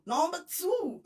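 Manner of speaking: number two called out high; excited. Real, not acted. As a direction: sing out the number, thrilled. Excited count of the news.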